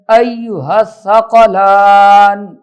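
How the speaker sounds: measured tajweed chant, last vowel held long; Quran recitation. Shown as a demonstration. Teacher reciting a verse for learners, slow tajweed recitation. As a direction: recite with a drawn-out final syllable.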